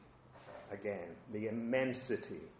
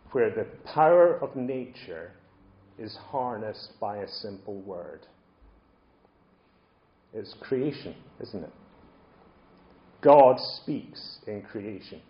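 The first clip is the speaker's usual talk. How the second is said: ordinary delivery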